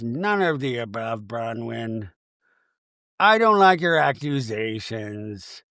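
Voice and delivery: low, soft, unbothered; Meredith, very calm. Retold or imitated. Meredith denies the accusations, mock calm. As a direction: deny it low and slow, without heat. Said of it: "Meredith very calmly goes".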